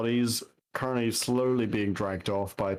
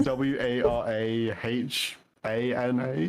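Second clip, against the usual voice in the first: spelling out slowly; slower than usual. Shown as a demonstration. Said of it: "How do you spell that?".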